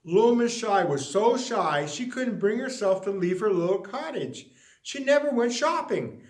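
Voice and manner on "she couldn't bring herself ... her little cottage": ordinary delivery, nothing marked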